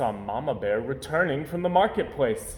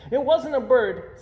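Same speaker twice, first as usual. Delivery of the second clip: cub voice; 12 semitones higher, 6 dB louder than usual. Acted. Cub confesses.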